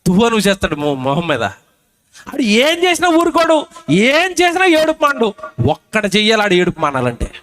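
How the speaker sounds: ordinary talk, nothing marked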